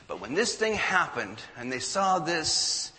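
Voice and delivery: ordinary talk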